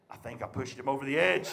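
low, softly spoken dad; low aside. Retold or imitated. Father to himself after the silence, low aside. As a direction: say it low and soft, imitating dad.

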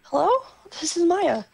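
lightly voiced young woman; girl voice. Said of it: "Let me bring out my inner girl voice".

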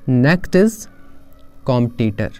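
ordinary talk, nothing marked

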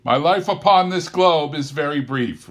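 high, loud solemn spirit voice; ghost voice. Acted. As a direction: speak as an otherworldly ghost. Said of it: "replied the ghost".

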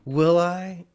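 high, loud mocking taunt; sarcastic taunt. A narrator's reading. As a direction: throw it back with dripping sarcasm. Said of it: "Jeannie taunted, her voice dripping with sarcasm".

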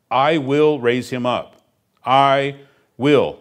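"will" hit hard and slow, loud and falling; stress on WILL. Shown as a demonstration. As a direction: lean on "will" as a firm promise. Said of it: "Not might, not should, not can, not will".

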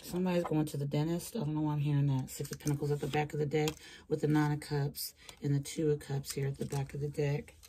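ordinary delivery

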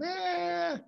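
short high mock scream of fright; a quick squeal at a mouse, half-hearted. Teacher acting scared of a rat, high squeal. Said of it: "a gritar".

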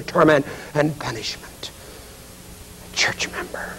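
dropping to a soft whisper; hushed, hollow ending; fading despair. Acted. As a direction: let it die away to a breath. Judas sinking back into suffering.

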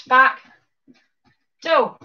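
ordinary delivery

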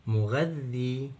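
مغذي slowly with a drawn-out final ee; long ya. Shown as a demonstration. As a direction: hold the doubled ذ and long ee of مغذي. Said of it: "مد ياء". (مدّ الياء في مغذي ببطء)

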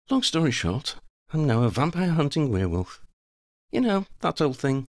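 high, breezy character voice; Lavender, offhand. Acted. Lavender explains being a werewolf now, offhand. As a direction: pitch it up and toss it off casually.

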